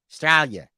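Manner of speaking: "Australia" as 'Straya, first A gone; clipped Australian pronunciation. Shown as a demonstration. Demonstrating Aussie speech to the host, "Australia" said as 'Straya. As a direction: swallow the opening A of "Australia". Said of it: "you can't say letter A".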